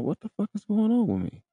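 high, startled inner cry; mock panicked what-the-hell; alarmed. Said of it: "I'm like".

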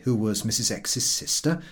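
usual speaking voice

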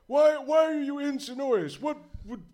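lightly voiced inquisitive old man; curious old wizard. Wizard asks purpose.

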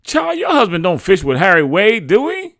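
high, loud, questioning woman's voice; confused neighbor. A narrator's reading. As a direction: call back loudly, puzzled, voice shooting up. Confused neighbor calls back.

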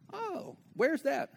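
slow curious question. Acted. Acted chat with a curious friend.

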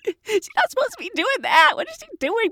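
high, scandalized aside; mock scandalized junior; shocked whisper-gossip. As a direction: act appalled, pitched high. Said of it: "the young girl again is just like".

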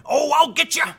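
high, hammed-up sour mutter of a sulking critic; mock a petty outraged opponent shaking a fist; mock grumbling critic. Mocking outraged critics of Trump, high whiny grumble. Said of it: "would you rather be sitting there going".

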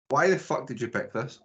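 normal talking voice

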